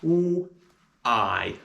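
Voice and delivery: oo then I, two sounds of why apart; sounding out why. Shown as a demonstration. Teacher showing the two sounds in why. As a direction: split "why" into oo and I. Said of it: "And the sounds of why are".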